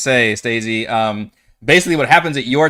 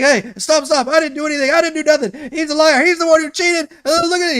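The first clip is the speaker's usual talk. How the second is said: panicked pleading; faster than usual. Acted. Wumpus being tackled by guard.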